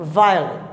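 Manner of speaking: "violent" com o O reduzido a um "â" átono; O fraquinho. Shown as a demonstration. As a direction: apoie no "vi" e passe leve pelo O. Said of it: "Ele ficou fraquinho lá". ("violent" como "VAI-â-lânt", mais grave)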